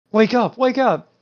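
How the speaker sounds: high, drawn-out mock wake-up call; pleading mock call. Kid to his sleeping Gengar, mock pleading call. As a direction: imitate a kid urging a sleeper awake.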